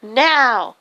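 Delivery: acted, mildly angry demand; mad demand. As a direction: order a partner to help, mildly cross. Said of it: "gets all mad at him".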